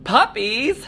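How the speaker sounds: high excited puppy love; delighted squeal. Real, not acted. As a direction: gush over puppies. Host delighted by puppies.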